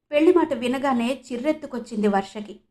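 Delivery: ordinary talk, nothing marked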